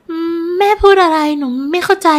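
high, bewildered pleading voice; distressed. Acted. Shaken daughter not understanding her mother, distressed.

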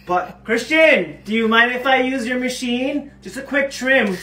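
high, loud, coaxing ask; sweetly hypocritical. Acted. Girlfriend sweetly begging to borrow his trimmer. Asking to use his machine.

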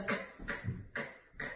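"K" as a bare voiceless burst; letter sound demo. Shown as a demonstration. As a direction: sound out "K" softly, with no vowel. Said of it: "Kato Kangaroo says".

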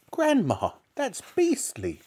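high, slow appalled protest; disgusted boy. A narrator's reading. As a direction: protest as a horrified small boy.

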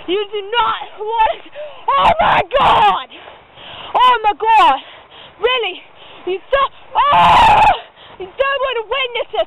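loud, alarmed exclamations; mock horrified shouting. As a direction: shout as if seeing a horror. Horrified at croc attack.

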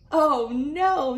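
lightly voiced happy granny; cheerful granny. Granny answers gleefully.

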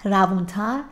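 usual speaking voice

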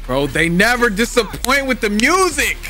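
high-pitched hyped reaction; excited praise. Real, not acted. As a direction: rave about it, voice climbing high. Excited reaction to trailer music.

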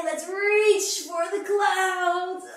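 put-on rally the kids upward; excited call. Excited kids yoga cue.

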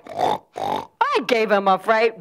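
slow, proud character voice; boastful animal. Acted. Animal boasting to the others after the chase.

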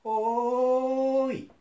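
slow, high, thread-thin calling out; thin wavering call. Acted. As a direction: call out weakly, lost and alone. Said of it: "糸より細い声を上げ".

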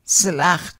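"slacht." with its L cut off short; "slacht." with a clipped glottal L; glottal L word demo. Shown as a demonstration. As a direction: stop the l in "slacht." sharply in the throat. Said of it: "abruptly stopped L".

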